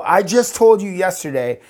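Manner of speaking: high-pitched, insistent objection; retold indignant pleading; pleading protest. Acted protest to Jesus.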